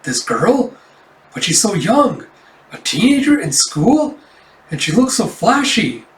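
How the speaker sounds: high, swooping disbelieving questions; struck, taken aback. Acted. Cafe owner sees the teenage replacement, taken aback. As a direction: let each short phrase leap up in shock. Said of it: "struck. She's like".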